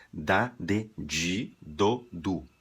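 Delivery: "di" said as Portuguese "dji"; Brazilian dji. Shown as a demonstration. Teacher showing the Portuguese habit, "di" as "dji". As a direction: demo "di" with the Brazilian j-sound. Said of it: "em português".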